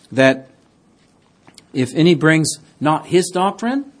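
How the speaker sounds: ordinary talk, nothing marked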